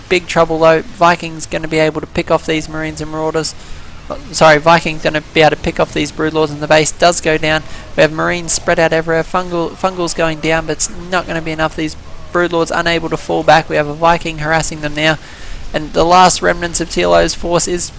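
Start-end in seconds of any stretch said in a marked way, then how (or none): none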